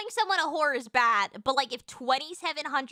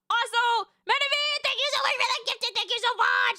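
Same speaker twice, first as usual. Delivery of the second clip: high, loud, rapid-fire gratitude; bubbly streamer thank-you, pitch way up; gushing thanks; 9 semitones higher, 5 dB louder than usual. Real, not acted. Thanking a viewer for gifted subs.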